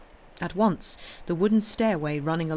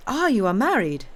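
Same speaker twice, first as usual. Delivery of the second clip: put-on louder, higher, Italian-accented question; interested Italian priest; 6 semitones higher, 7 dB louder than usual. A narrator's reading. Priest learning his host is married, interested Italian accent. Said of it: "the priest said with some interest".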